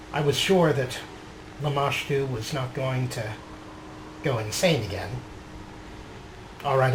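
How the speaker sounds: measured, reflective diary reading; journal narration. A narrator's reading. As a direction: read it like a character's private journal. Reading his character's journal aloud.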